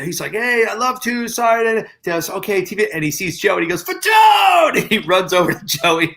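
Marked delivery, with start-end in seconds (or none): 0.3-2.9 high, lightly acted polite brush-off; a hurried, friendly excuse in a raised voice; Tom Hanks. Star excusing himself to a TV crew, lightly imitated. 3.8-4.9 delighted, drawn-out shout; Tom Hanks. Retold or imitated. Star spotting Joey across the carpet, hollering happily.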